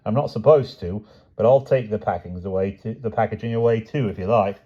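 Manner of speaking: high, chatty Cockney delivery man; cheerful Cockney patter; a Cockney van driver reading his list. A narrator's reading.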